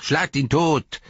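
high, loud sneering echo; mocking imitation. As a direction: ape the mob's cry. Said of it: "äffte er sie nach".

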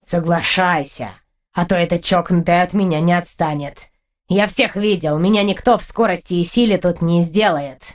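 low, self-assured creature voice; deep cat voice, sure of winning; proud cat. A narrator's reading. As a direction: admit your prowess proudly, pitched low. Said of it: "гордо признался кот".

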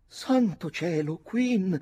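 put-on high, drawn-out girl's exclamation; theatrical young lady's cry. A narrator's reading. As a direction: cry out in shocked protest. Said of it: "gridò la ragazza".

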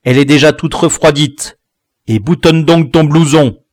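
cross parental order; scolding parent. A narrator's reading. Father scolds his late son.

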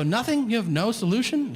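high-pitched incredulous challenge; baffled, exasperated customer; exasperated disbelief. Retold or imitated. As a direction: demand an answer, voice jumping way up. Exasperated customer confronts cashier.

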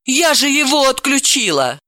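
taken aback, indignant; exclaiming. Acted. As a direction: cry out in baffled protest. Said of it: "она воскликнула".

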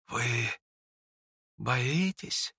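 soft, halting, probing; quiet, hesitant challenge; hushed question. A narrator's reading. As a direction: ask it slowly with a long pause.

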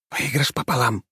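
plain reading voice

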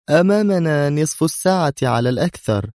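usual speaking voice

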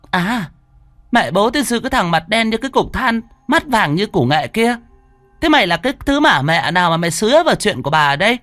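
shrill, very high, fast screaming abuse; furious cursing. A narrator's reading. Concubine curses the sorcerer, shrieking very high and fast. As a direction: spit insults at him, stamping with rage. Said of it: "dậm chân bành bạch".

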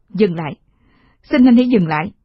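loud desperate plea; pleading shout. Acted. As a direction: shout at him to stop. Begging him to stop.

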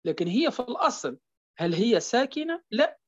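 usual speaking voice

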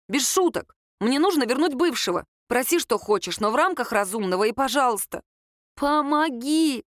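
argue, then draw out the final word in appeal; pleading Lera. Acted. Girl begs Kirill for help, exasperated and pleading.

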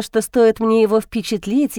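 plain reading voice

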